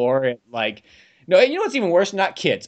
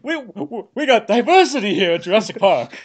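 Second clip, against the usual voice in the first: mock Hammond; 7 semitones higher than usual. Mocking Hammond showing off diversity.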